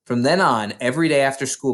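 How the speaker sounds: usual speaking voice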